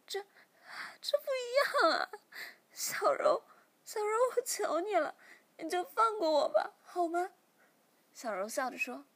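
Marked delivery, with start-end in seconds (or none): 0.1-7.3 high, loud sobbing plea; crying plea. Acted. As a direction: beg through tears, voice cracking high. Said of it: "小苗马上哭着说".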